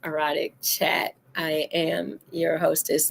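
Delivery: ordinary delivery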